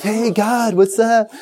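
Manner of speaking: high, breezy surfer-style greeting; casual dude. Retold or imitated. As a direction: toss it off like a laid-back guy in cutoffs. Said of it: "they're like".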